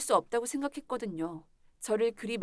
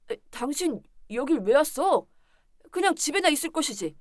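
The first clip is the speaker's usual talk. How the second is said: louder, higher defensive outburst; annoyed blaming; 3 semitones higher, 7 dB louder than usual. Acted. Caught husband scolds wife for coming, annoyed and blaming. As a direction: turn the blame on her, exasperated.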